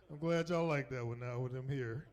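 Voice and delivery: ordinary talk, nothing marked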